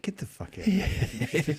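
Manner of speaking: slow, alarmed brush-off; mock dismissal. A man rebuffs a stranger who knows his name, mock alarmed. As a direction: back off a stranger, half joking. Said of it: "I'd be like".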